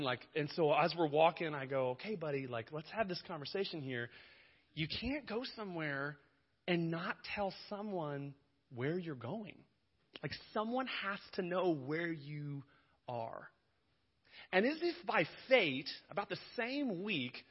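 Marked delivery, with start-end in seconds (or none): 1.9-13.5 softer, slow fatherly talk; calm correction for the little boy; a father calmly explains to his son, softer and slow; gentle fatherly lecture. Retold or imitated.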